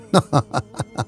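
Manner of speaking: short mocking laugh; scornful laugh. Real, not acted. Preacher scoffing at demons, short mocking laugh. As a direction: laugh off the threat.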